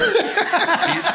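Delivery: sudden high burst of laughter. Real, not acted. Laughing hard at own joke to listeners. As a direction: crack up at your own joke.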